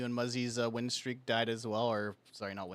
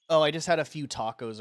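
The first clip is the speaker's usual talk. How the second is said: quoting himself, louder; 5 semitones higher, 12 dB louder than usual. Retold or imitated. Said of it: "I was just like".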